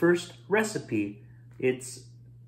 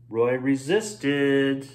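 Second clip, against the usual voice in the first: ordinary delivery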